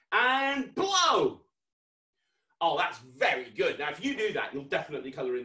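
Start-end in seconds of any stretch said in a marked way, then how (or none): 0.1-1.4 high, drawn-out launch command; shouted cue. Real, not acted. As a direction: build on "and", then shout the cue. Leads practice blow with children.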